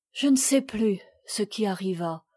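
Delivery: usual speaking voice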